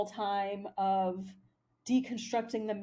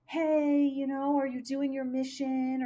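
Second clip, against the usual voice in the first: higher, louder sing-song preachy parody; mock spiritual cow; 4 semitones higher, 3 dB louder than usual. Imagined cow preaching to another cow, mock spiritual. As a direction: lampoon a preachy guru, brightly. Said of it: "spoke like spiritual people and said".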